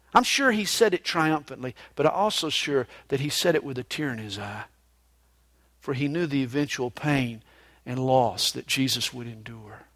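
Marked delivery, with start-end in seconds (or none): none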